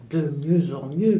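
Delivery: ordinary delivery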